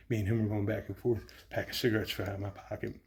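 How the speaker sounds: ordinary talk, nothing marked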